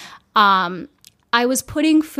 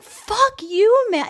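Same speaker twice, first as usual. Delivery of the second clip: high-pitched retold outburst; indignant, pitch shooting up; retold anger; 11 semitones higher, much slower than usual. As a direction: re-enact lashing out at him. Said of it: "I just got so mad at him".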